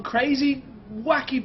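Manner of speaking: excited. Acted. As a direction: sell the word crazy big. Excited channel intro.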